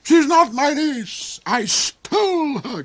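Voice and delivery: high, loud, slow cry; cried out. Acted. The Duke cries to Hark she is no niece, high and loud. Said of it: "cried the Duke".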